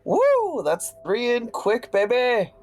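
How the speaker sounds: high, gleeful cheer; excited whoop. Real, not acted. Player cheering an extra dot, gleeful high whoop. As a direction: whoop it like a win.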